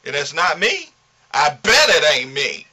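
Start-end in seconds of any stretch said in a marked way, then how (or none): none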